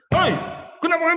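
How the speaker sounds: mock furious high shout; emotional angry yell. Wife yelling at her small child over a mistake, angry shout. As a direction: imitate an impatient parent exploding, loud and high. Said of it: "dia akan emosi".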